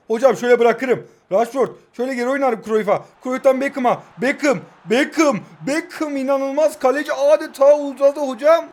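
loud, rising football commentator call; excited play-by-play. Acted. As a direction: call the match like a live TV commentator. Live play-by-play of own match.

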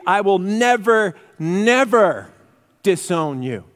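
both "never"s hit loud and high; emphatic double never. Acted. Peter insisting to Jesus, each "never" loudly stressed. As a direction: punch "never, never" hard, each one falling. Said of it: "so it really should read".

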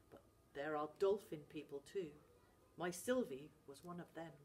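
father confessing with gravity; gruff Scottish dad. Acted. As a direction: reveal the Selkie secret. Dad tells the truth.

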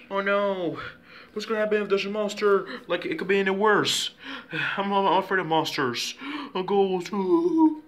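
high, hammed-up scared voice; pretend fright. Child fleeing a monster in make-believe, mock frightened high voice.

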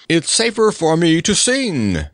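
high, mildly mournful knight. A narrator's reading. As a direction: lament it in a lifted voice. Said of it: "said the knight mournfully".

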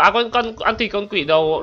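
ordinary delivery

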